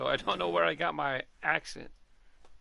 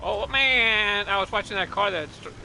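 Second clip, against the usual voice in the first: frustrated outcry; 6 semitones higher, 9 dB louder than usual. Real, not acted. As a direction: cry out, stretching the word man. Missed boost at race start.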